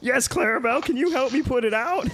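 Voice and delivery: put-on high squeaky man-child; bright high plea for help; Pee-wee Herman voice. Pee-wee answers Clarabelle.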